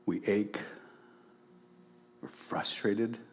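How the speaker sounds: heartfelt spoken prayer, softening. Real, not acted. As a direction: pray aloud, sincere and hushed.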